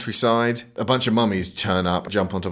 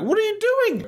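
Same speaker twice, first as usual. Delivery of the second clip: squeaky, much higher silly voice; mock baffled question. The heroes confronting the captors, squeaky high mock. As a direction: squeak it out in disbelief. Acted quote in recap.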